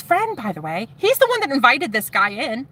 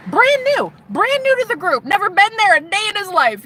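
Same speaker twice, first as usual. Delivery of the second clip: incredulous emphasis; 11 semitones higher than usual. Real, not acted. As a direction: stress how brand new he was. Stressing newcomer's newness.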